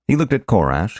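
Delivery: plain reading voice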